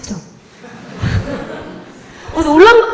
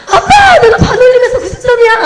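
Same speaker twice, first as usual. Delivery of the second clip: very high, loud yell of triumph; excited shout; 11 semitones higher, 6 dB louder than usual. Retold or imitated. Child running to dad with a top score, shouting excitedly. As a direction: call out to dad at the top of your voice, thrilled. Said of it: "하면서 막 달려갔어요".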